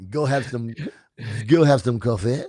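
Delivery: comic cartoon French accent; exaggerated French-accented English; Pepe Le Pew. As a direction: do a suave mock Frenchman. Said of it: "Pepe Le Pew has entered the conversation".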